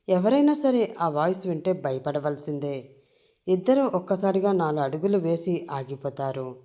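ordinary delivery, nothing marked

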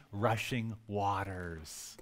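a hushed, sinking "waters"; low, soft, lingering ending; drawn-out fade. Real, not acted. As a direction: let the word trail away low and soft. Dramatic trailing of rushing waters.

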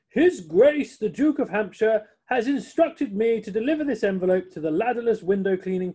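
loud, high, pompous announcement; formal chauffeur. Acted. Chauffeur delivers the Duke's message, pompous. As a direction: announce His Grace grandly.

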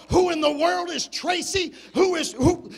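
loud, rising demand; suspicious wife. Retold or imitated. Wife finds text from Tracy.

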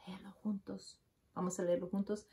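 whispered aside. Real, not acted. Reader whispering to the children to read along.

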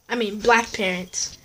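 usual speaking voice

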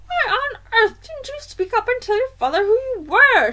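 high, loud grandmother voice; scolding grandmother. A narrator's reading. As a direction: scold the boy in exasperation.

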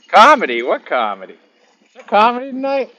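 high loud playful disbelief; mock surprise. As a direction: act shocked, pitch jumping high.

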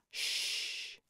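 long hushed shush. Acted. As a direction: shush them softly. Hushing others while someone sleeps.

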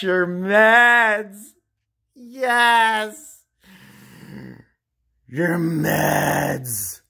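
loud, drawn-out cry; hammed-up outburst. Cooking host erupting about meds mid-recipe, loud and manic. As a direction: blurt it out and stretch it.

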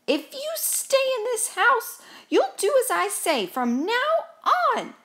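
high, emphatic scolding; stern command. A narrator's reading. Gus gives Mouse rules for the house, stern command. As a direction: lay down the law forcefully.

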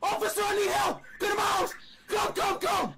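high, hammed-up screaming plea; fake panic. Prank caller begging officer to come, fake panicked screaming. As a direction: shout for help in mock desperation.

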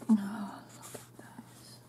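slow hushed whisper; soft slow whisper of delight; slow awed whisper. Real, not acted. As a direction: breathe it out slowly in wonder. Awed unboxing of gifts.

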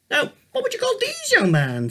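gently quizzing grandfather; lightly voiced old man. A narrator's reading. Grandfather teasing the boy with a riddle, lightly voiced.

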